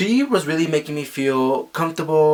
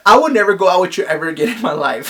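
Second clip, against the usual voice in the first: fed-up vow; 4 semitones higher, 4 dB louder, faster than usual. Retold or imitated. Vowing never again.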